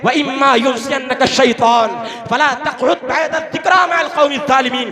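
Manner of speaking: chanted Quran verse in a sermon; Quranic recitation. Acted. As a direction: intone the Arabic verse with force.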